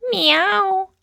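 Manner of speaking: high mock meow; cat meow. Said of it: "I'm Rose the cat".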